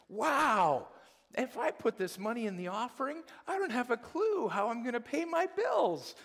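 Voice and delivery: high, loud, eager voice; excited giver. Retold or imitated. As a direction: gush like a giver with no money left. Pastor voices a cheerful giver.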